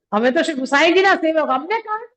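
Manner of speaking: proud of his guru, scoff at death; proud defiance. Acted. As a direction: fearless devotee mocking death. Devotee boasts he fears no death.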